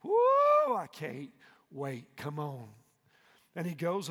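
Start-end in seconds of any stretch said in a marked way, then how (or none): 0.0-0.8 very high, loud shouted woo; preacher whooping with excitement; ecstatic whoop. Real, not acted. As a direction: let out a joyful holler. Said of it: "ecstatic whoop".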